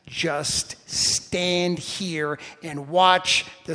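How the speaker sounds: slow, drawn-out words, stressed; slow, weighty command. Acted. God telling Moses to wait, slow and weighty. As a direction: draw out each word of the command.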